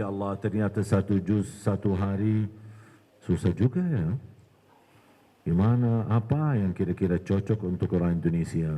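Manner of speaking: low-pitched pondering to oneself; berpikir sendiri. Retold or imitated. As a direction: think aloud in a deep, musing tone. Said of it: "Saya habis fikir". (merenung dengan suara rendah)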